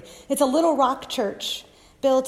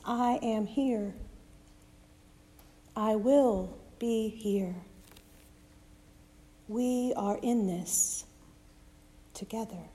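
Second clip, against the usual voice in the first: soft and gentle; 6 dB quieter than usual. Acted. Jesus promising the disciples, soft and gentle. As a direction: comfort them quietly. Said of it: "sticking with soft and gentle".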